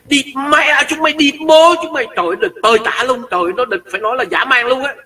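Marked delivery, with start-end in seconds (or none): 0.1-2.1 put-on higher, cursing in a Northern Vietnamese accent. Northern Vietnamese heckler swearing at the crowd. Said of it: "Nó chửi".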